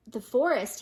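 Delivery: ordinary delivery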